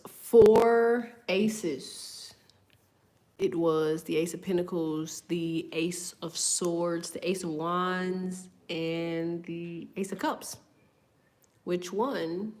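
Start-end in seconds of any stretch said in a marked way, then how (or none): 0.1-10.6 slow, drawn-out reading of the cards. Acted. Reading the oracle cards slowly to viewers. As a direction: spell out each card slowly. Reads out tarot card message.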